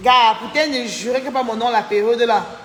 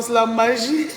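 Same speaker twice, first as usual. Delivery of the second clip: ordinary talk, nothing marked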